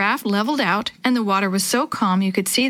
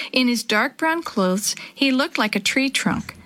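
plain reading voice

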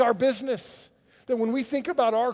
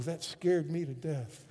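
ordinary delivery

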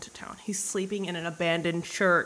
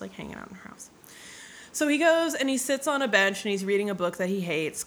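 normal talking voice

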